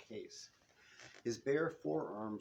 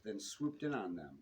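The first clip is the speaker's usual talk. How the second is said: ordinary delivery, nothing marked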